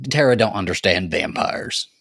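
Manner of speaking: ordinary talk, nothing marked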